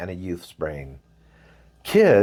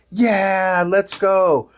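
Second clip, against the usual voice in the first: put-on eager kids; 8 semitones higher, much slower than usual. Junior high kids agreeing to poke the creature, eager high yell. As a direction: jump at the chance with bright eagerness.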